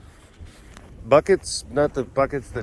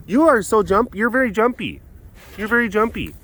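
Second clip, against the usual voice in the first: high-pitched cooing at an animal; pet talk; 8 semitones higher than usual. Real, not acted. Keeper to a very jumpy animal, high pet-talk voice.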